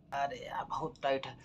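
whispered aside. Acted. Doctor whispers an aside while struggling. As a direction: breathe it under your breath. Aside about tight thing.

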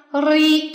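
"리" held long and firm; long vowel. Shown as a demonstration. Teacher points out the long syllable, long vowel. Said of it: "这个长的".

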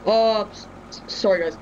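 flustered sudden apology; startled. Real, not acted. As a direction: caught off guard, stammering. Interrupted by dad calling.

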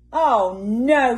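Mister Bear at the end of his tether; despairing wail; exasperated groan. Acted. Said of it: "said Mister Bear".

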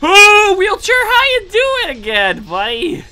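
high, loud startled greeting; jumpy surprise; startled squeal. Real, not acted. Startled by wheelchair again.

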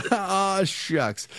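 copying aw shucks. Retold or imitated. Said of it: "I'm gonna steal that from you".

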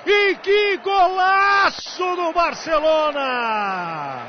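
roaring, stretched goal call; explosive goal commentary. Acted. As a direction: scream the goal with joy. (narração de gol explosiva)

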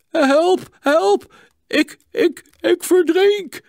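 high, loud cries for help; desperate scream. A narrator's reading. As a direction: scream it out as a drowning man. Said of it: "schreeuwde hij vertwijfeld".

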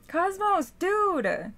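loud, high-pitched scolding; exasperated calling out. Real, not acted. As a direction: yell at the cat to get his attention. Scolding the intruding cat.

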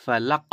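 "ফালাক" ending in a short bounced q; echoing qalqala. Shown as a demonstration. Teacher repeats for learners, "ফালাক" with echoed final q. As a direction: release the last consonant of "ফালাক" with a small echo. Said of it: "প্রতিধ্বনি করে পড়তে হবে".

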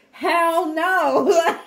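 loud, high mock refusal; mock hell no. Viewer refusing the news, loud and high-pitched. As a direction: blurt it out high like an outraged viewer.

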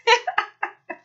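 high, mischievous giggle; squeaky gloating giggle; naughty high-pitched tittering. A narrator's reading. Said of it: "The troublesome trucks giggled".

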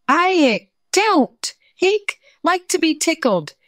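high, loud words broken by hiccups; desperate, hammed-up hiccup fit; hiccuping tiger. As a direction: keep getting interrupted by exaggerated hiccups. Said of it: "carried on having the hiccup".

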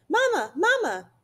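put-on very high baby bird cry; peeping gosling. A narrator's reading. Gosling calls for its robot mother, high peeping. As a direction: chirp it out like a needy chick.

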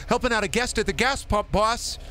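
a hammed-up cheery clerk talking to his boss; put-on retail worker. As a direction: chirp it out like a worn-down gas station clerk. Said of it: "Oh, I hate that".

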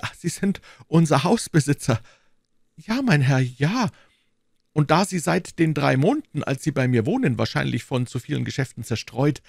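high, fussy voice of the timid landlord; anxious little burgher. Acted. Bonacieux explaining to D'Artagnan, high and anxious. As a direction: play the nervous shopkeeper, pitched well up.

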